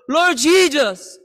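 usual speaking voice

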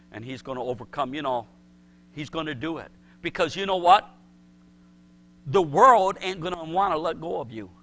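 ordinary delivery

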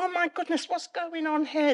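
high, alarmed inner voice; retold alarm. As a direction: replay your startled panic, high-pitched. Retold alarm at husband's thrashing.

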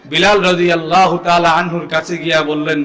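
ordinary talk, nothing marked

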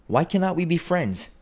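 high-pitched, earnest appeal; pleading. A narrator's reading. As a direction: appeal to uncle. Nephew pleads for friendship.

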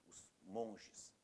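ordinary talk, nothing marked

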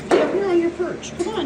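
high, loud sing-song coaxing. Real, not acted. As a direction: coax the bird up, high and bright. Coaxing parrot onto perch.

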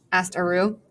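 ordinary delivery, nothing marked

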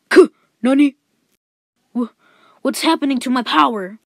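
loud startled cry of disbelief; villain stunned his power fails; shocked anime villain. Retold or imitated. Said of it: "Last time on Dragon Ball Z".

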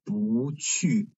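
不去 with 不 rising, 去 falling; 不 tone sandhi. Shown as a demonstration. Teacher showing the rule, 不 in 不去 rising. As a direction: say 不 as second tone before a falling 去. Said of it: "its tone change into second tone".

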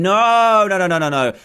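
normal talking voice

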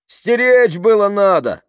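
high, drawn-out heckle; shouted. A narrator's reading. People behind blaming the driver, shouted. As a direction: yell the accusation from the back. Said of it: "крикнули сзади".

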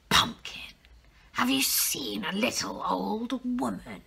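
hushed, sly wolf voice; a sneaky wolf sniffing around. Acted. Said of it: "said the wolf".

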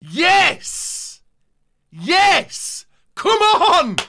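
high, loud bursts; real jubilant yelling; triumphant shout. Real, not acted. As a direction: punch out each cheer in celebration. Celebrating a big pull.

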